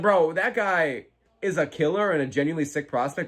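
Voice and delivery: normal talking voice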